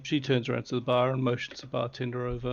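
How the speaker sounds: normal talking voice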